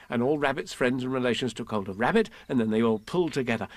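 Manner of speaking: plain reading voice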